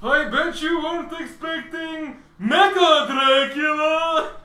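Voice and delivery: put-on booming villain voice through old speakers; slow, high and loud, like a crackly PA; rumbling robot speaker voice. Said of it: "a rumbling voice ... from speakers".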